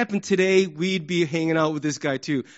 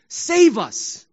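pleading cry pitched up for rescue; urgent plea; 6 semitones higher, 4 dB louder than usual. Acted. People begging for deliverance, pleading. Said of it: "it started out as a request, a plea".

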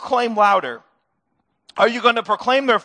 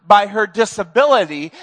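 ordinary delivery

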